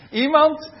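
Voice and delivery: hammed-up flight attendant shooing someone into the last seat; mock bossy attendant. Staff forcing someone into seat.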